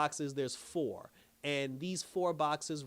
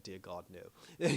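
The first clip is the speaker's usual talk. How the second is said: low, slow, hushed dismay; understated mournful disbelief, dropped low; mock grief; 8 semitones lower, 4 dB quieter, slower than usual. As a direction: lightly act out solemn shock, slow and low. Retold reaction to death news.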